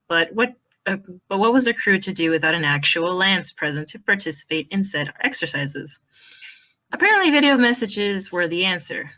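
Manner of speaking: steady read-aloud storytelling voice; story narration. Acted. Narrator reading the fanfic chapter aloud to friends, storytelling.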